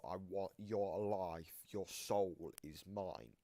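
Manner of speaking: ordinary talk, nothing marked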